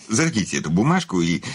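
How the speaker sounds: ordinary talk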